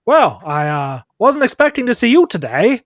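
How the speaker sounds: loud, lifted voice, a little taken aback; doctor character. Acted. Doctor greets an unexpected visitor in a high, loud voice. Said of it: "he says".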